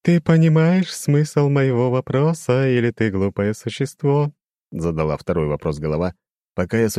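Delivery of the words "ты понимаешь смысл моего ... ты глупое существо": high, slow, ringing demon voice; eerie chiming demon, condescending; crystal ringing voice. A narrator's reading. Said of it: "да ещё таким голосом". (высокий звенящий хрустальный голос демона)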